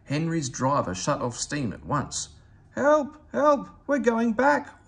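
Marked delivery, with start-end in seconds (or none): 2.8-4.9 high loud frightened wail; wailing for help. A narrator's reading. Henry pushed back out of the tunnel, high loud panicked wail. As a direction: cry out in panic as you're pushed. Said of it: "wailed Henry".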